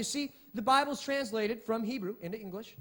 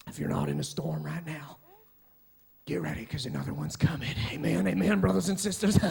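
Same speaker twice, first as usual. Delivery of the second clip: old Baptist minister impression; 8 semitones lower than usual. Pastor mimicking an old Baptist minister to his congregation, hushed then rousing preacher voice. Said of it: "I was gonna go on with the impression".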